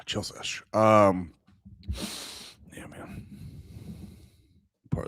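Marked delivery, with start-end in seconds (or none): 0.0-0.6 soft whisper after a sip. Real, not acted. After first sip of water. 2.8-3.2 soft whisper. Real, not acted. After first sip of water.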